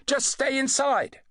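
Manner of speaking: high, slow called instruction; calling out. A narrator's reading. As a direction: call it out slowly over the racket. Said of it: "the doctor called".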